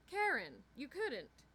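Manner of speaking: high, loud "Karen" falling in dismay; dismayed reproach. A narrator's reading. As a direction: reproach a friend in disbelief. Friend reproaches her lapse.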